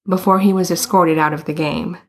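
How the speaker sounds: ordinary delivery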